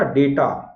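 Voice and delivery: डेटा as 'day-ta'; teacher repeats 'day-ta' clearly; correct pronunciation. Shown as a demonstration. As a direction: model डेटा with long 'e', falling. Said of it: "याद रहेगा".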